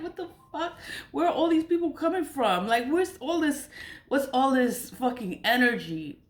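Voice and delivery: high-pitched, incredulous outburst; exasperated disbelief. Retold or imitated. Retells her exasperated reaction to attacks.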